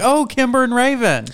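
high, lightly gushing greeting; starstruck doorman. Retold or imitated. Doorman recognising the stars, mock gushing high welcome. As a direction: mimic staff who spot the stars at the door. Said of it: "they're like".

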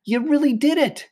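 very high, thrilled cheering; excited shout. A narrator's reading. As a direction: celebrate your pupil's success in a high voice. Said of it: "Marty shouted".

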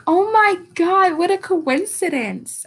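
high, slow amazed gasp; mock surprise. Vlogger retelling her reaction to the episode, high mock amazement. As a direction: act dazzled by the coincidence. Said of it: "And I was like".

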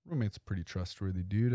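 normal talking voice

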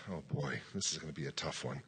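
very low, soft aside; thinking to himself. Retold or imitated. Preacher privately sizes up a tough exorcism, low inner-voice aside. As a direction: drop right down as if privately daunted. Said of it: "I'm thinking to myself".

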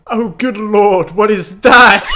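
posh shocked British voice, loud and slow; British accent impression. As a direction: gasp it out like an appalled Englishman. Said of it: "that's a good impression".

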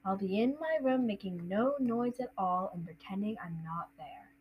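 put-on soft, monotone boy's recital; Harry. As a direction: recite it dully by rote. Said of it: "it's Harry".

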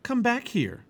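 loud call to his sister; boy yelling to his sister down the row. Acted. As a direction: call her back firmly and loudly. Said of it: "yelled Jack".

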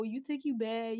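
ordinary talk

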